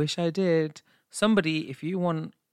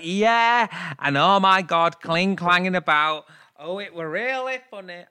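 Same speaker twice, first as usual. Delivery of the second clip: loud, higher Yorkshire impression of spouse; hammed-up tipsy Yorkshire caricature; mock Yorkshire accent; 4 semitones higher, 8 dB louder, slower than usual. Mocking husband's old podcast voice, exaggerated Yorkshire accent. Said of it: "why you had that Yorkshire accent".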